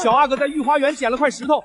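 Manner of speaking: ordinary delivery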